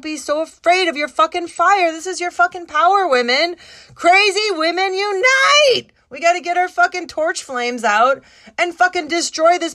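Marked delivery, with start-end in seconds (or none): 3.9-5.9 slow, high, loud chant of a slogan; rally the crowd with a drawn-out battle cry. Real, not acted. Rallying women against the world, a slow loud battle cry.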